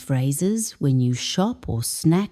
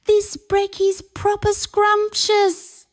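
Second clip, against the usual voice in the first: high, bright-eyed delighted voice; a grinning mum praising breakfast; grinning Priya. Acted. Mum grinning over breakfast, high delighted voice. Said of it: "She grins".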